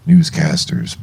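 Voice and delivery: ordinary delivery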